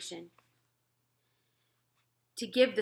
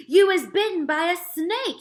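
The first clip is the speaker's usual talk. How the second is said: much higher, louder alarmed shout; giant shouting; 10 semitones higher, 12 dB louder than usual. Acted. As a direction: scare the coward with the news. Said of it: "he shouted".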